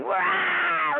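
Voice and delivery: high yelp of surprise, imitating a patient; startled wow. Patient at a party, feet squeezed, high startled yelp. Patient reacting to foot massage.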